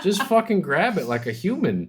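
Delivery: high, laughing scold; laughing at a friend crushing a can; laughing exasperation. Real, not acted.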